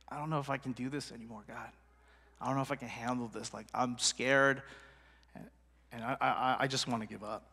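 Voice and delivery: a worn-out prophet barely able to go on, hushed; despairing Elijah. Acted. Elijah telling God he wants to give up, hushed and despairing.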